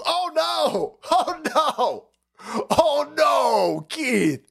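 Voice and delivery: high, hammed-up fake whimper of alarm; mock dismay. Vlogger mocks a pundit's upset with fake crying. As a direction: pretend to be heartbroken, sarcastically.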